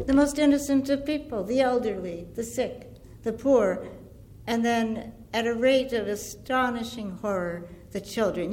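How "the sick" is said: ordinary talk, nothing marked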